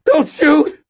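high, loud terrified plea. A narrator's reading. Cashier begs the robber, terrified. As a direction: beg for your life.

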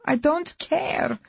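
high, slow anguished repeat; slow anguished cry. Acted. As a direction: drag it out in despair. Wife repeats it slower and higher.

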